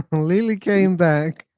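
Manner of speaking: slow laugh-filled repeat of the news. Real, not acted. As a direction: laugh through the words. Laughing after Lily returns.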